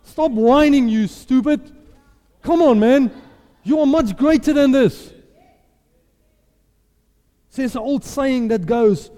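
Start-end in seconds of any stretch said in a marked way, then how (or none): 0.0-5.1 loud, high self pep talk; forceful, raised-voice rallying; psyching himself up. Acted. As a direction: scold yourself into courage. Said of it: "I psych myself up and I say".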